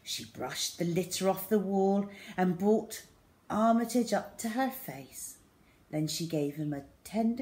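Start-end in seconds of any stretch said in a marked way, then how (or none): none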